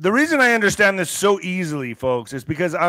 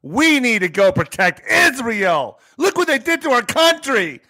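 hammed-up indignant patriot, higher pitched; mock outrage; 7 semitones higher, 4 dB louder than usual. Mocks outraged war supporters.

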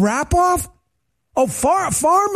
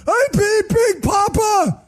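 much higher, slow drawn-out shout; Biden scream; 10 semitones higher, slower than usual. Retold or imitated. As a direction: yell the boast like a raving loser. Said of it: "He should have screamed like Biden".

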